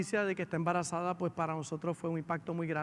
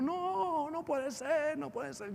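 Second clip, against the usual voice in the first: starts as a high, pained cry and sinks to a broken softer plea; anguished cry, fading; 9 semitones higher, 3 dB quieter, much slower than usual. Retold or imitated. A sister refusing the pregnancy news, anguished cry fading out. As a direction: cry out in disbelief, then let it drain away. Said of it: "gritaba ella".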